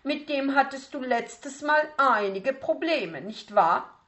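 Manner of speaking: a strict teacher reminding him of his mistakes; piano teacher. Acted. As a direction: strenge Lehrerin. Teacher questions pupil.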